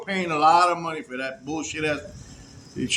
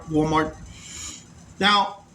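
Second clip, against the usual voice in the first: normal talking voice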